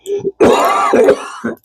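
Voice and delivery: a hacking cough, congested chest; real chesty cough. Real, not acted. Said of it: "I'm getting over a cold".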